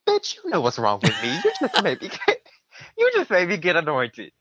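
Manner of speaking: squeals up high, laughing through it; laughing outrage. Real, not acted. Retelling his mother making him get anointed, laughing indignation. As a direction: laugh while venting, voice jumping up high.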